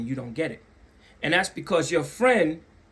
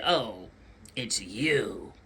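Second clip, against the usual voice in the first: low, flat unimpressed "it's you"; cold disdain; 7 semitones lower, 5 dB quieter, much slower than usual. Retold or imitated. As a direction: sound let down to see him. Cold reception mimicked.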